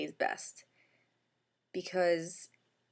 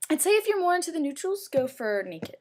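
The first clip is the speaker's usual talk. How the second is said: emphatic; 8 semitones higher, 6 dB louder than usual. Real, not acted. Emphatic recommendation.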